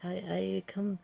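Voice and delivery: grand, theatrical showoff with a put-on French accent; bad French accent. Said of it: "I am sorry to all French people".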